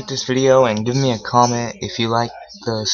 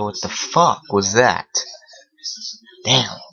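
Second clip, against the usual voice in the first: usual speaking voice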